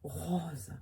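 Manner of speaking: "Rose" softly, attending to the R; Toulouse pronunciation. Shown as a demonstration. Coaching listeners on the R, Toulouse pronunciation. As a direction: model the R of "Rose" quietly in a Toulouse accent. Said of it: "c'est toujours au niveau du R".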